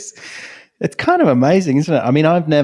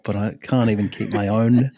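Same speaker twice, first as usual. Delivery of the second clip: ordinary talk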